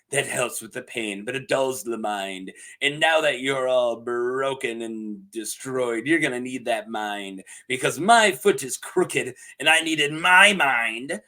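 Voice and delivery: low, measured storyteller imitation; tale-telling Larys. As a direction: drop the voice low and draw out the key words. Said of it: "he tells him a tale. He's like".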